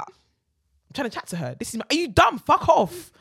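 mock-aggressive man, rising to a loud snap; imitating an angry man. Man's rude reply retold.

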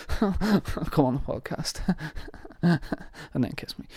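laughing, slow and soft, fading to a whisper; coy breathy invitation through giggles; giggly flirt. Pretend. Inviting the actor on the podcast, giggling flirtatiously.

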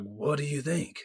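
mock French accent, lightly done; terrible French accent. Co-host mimics Simon at the art show, terrible French accent. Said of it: "terrible French accent and was like".

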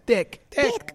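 very high parrot squawk; talking parrot. Retold or imitated. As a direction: repeat it high like a pet parrot. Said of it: "he just says dick all the time".

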